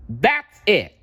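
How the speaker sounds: high, loud outburst; cried out. A narrator's reading. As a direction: burst out, fed up. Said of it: "Wish Bear cried".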